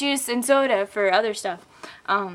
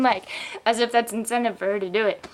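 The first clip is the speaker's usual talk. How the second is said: ordinary talk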